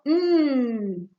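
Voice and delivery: "mm" as a long steady hum; isolated nasal sound. Shown as a demonstration. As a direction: hold "mm" as a drawn-out consonant hum. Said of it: "cambiar por el sonido".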